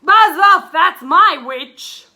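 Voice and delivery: loud, rough beast shout; beast's threat. A narrator's reading. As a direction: bellow it at the dragon, loud. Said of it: "it said to the dragon".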